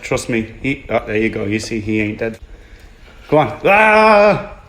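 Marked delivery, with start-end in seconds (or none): 3.6-4.6 sudden high yelp of alarm; startled yell. Real, not acted. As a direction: cry out as it lunges. Eel escapes during fight.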